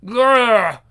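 loud fighting growl; snarl. Acted. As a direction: snarl like an angry beast.

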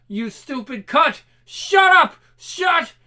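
high, loud raging yell; furious shouting. Acted. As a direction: explode in rage. Captor in final rage.

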